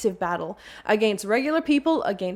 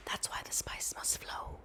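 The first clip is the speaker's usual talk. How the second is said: whispered, much softer aside; hushed whisper; 18 dB quieter than usual. Acted. Dune fan quoting the spice line, whispered. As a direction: whisper it like a secret prophecy.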